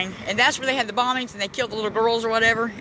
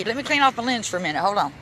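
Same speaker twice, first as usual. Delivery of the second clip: ordinary talk, nothing marked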